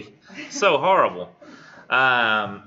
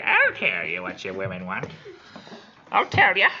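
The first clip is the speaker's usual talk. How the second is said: put-on atrocious old hag voice; 3 dB louder than usual. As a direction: screech it like an awful old crone. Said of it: "it's gotta be atrocious too".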